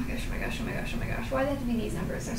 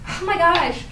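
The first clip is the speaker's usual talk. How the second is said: higher, louder oh my gosh; real shocked gasp; 7 semitones higher, 4 dB louder than usual. Real, not acted. Player reacting to the vampire twist, stunned. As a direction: let the shock hit.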